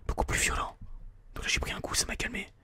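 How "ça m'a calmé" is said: ordinary talk, nothing marked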